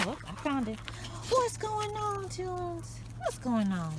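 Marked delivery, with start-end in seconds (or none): 1.3-3.9 much higher, excited greeting; high dog-praise voice. Real, not acted. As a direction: squeal it up at the dog.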